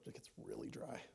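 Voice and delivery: breathy, voiceless words through a parched throat; dry throat whisper. Real, not acted. Preacher complaining of his dry throat, voice gone to a whisper. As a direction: croak it out with no voice. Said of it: "Don't you hate that when the back of your throat".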